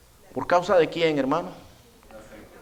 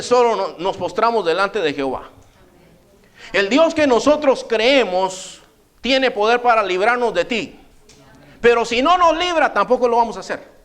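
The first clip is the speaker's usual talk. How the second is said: higher, proud defiant reply; chest out, defiant; 6 semitones higher than usual. Retold or imitated. Three young men answering the king, proud and defiant. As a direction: stand your ground with your chest out.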